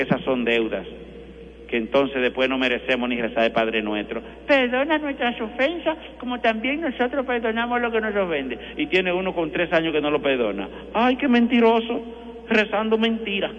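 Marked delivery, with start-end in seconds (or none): 4.5-8.6 high, put-on prayer recitation; mock recited prayer. Quoting the Lord's Prayer. 10.9-13.5 mocking reproach. Real, not acted. Priest mocks hypocrite.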